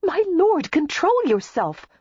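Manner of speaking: high-pitched, flustered scolding; alarmed protest. A narrator's reading. Daisy dodging a suitor's advances, alarmed. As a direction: fend him off in a high squeal.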